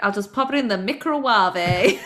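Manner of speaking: playfully mangled "microwave", said as mee-cro-wah-vay; Nigella Lawson. Retold or imitated. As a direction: make "microwave" a fancy four-syllable foreign word. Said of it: "that clip of Nigella Lawson being like".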